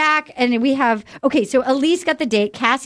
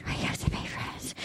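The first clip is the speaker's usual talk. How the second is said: soft, voiceless whisper; mimicked hushed whisper; imitated whispering; 14 dB quieter than usual. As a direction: whisper it as if barely audible. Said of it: "Hannah B is just whispering".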